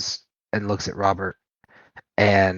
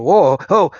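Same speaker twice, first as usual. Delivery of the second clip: higher, louder alarmed cry; startled yelp; 8 semitones higher, 10 dB louder than usual. Acted. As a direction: yelp as the ground gives way. Man sinks into the mud.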